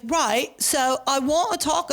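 a hint of Cockney London accent; mock Cockney accent. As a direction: imitate [name]'s put-on Cockney accent. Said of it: "not a posh London accent".

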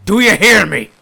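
loud, high threat; captain roars at the Orc police; angry shout. Acted. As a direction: bellow it as a warning.

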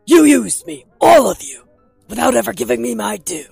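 very loud, high-pitched shouted accusation; furious outburst. Acted. Max accusing all of them of using him, shouting furiously. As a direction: explode on the first words and keep shouting.

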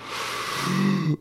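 shocked intake of breath; sharp gasp. Acted. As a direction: gasp in alarm. Reacting to shots heard.

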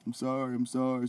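Adam Cole, tearful. Retold or imitated. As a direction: imitate a weepy apology, lightly. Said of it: "Adam Cole start crying and shit".

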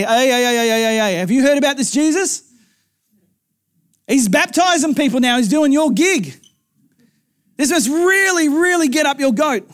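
comic high-pitched stirring-up; agitated tattling. Acted. Acts disciples tattling to John.

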